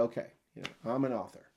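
low, soft, offhand admission; understated claim. Acted. New author owning up to the title, understated. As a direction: drop it in casually, quietly. Claims credibility casually, afterwards.

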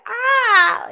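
a little scared yelp; mild mock shriek of fright; mock startled yelp. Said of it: "I probably would have screamed, like, not screamed".

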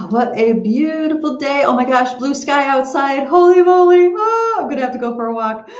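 bright, high and loud with delight; gushing over a sunny day; excited. Real, not acted. Delighted at blue sky outside.

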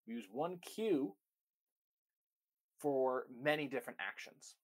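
ordinary talk, nothing marked

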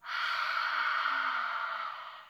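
long breathy dragon exhale; dragon breath sigh. Shown as a demonstration. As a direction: blow out like a dragon. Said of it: "In through your nose".

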